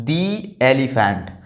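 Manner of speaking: "the elephant" slow, "the" as "dee". Shown as a demonstration. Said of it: "दी pronounce कर रहे हैं". ("the elephant" में "the" को "दी" बोलें)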